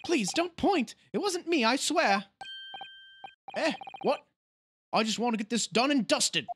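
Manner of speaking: flustered juror. Acted. Juror protesting the pointing, high flustered voice. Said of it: "nervous juror voiced".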